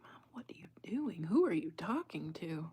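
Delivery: the dog's thoughts, whispered; dog voicing her complaint, whispered. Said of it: "She's like".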